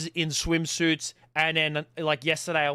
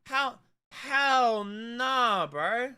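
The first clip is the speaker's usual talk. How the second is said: long drawn-out, high-pitched hell nah; emphatic denial; 7 semitones higher, much slower than usual. Real, not acted. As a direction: stretch the words right out in emphatic refusal. Emphatic denial of own good looks.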